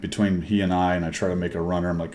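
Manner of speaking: ordinary talk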